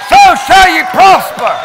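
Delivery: loud, high proclamation; shouted declaration. Real, not acted. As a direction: cry it out to the church. Preaching repetition.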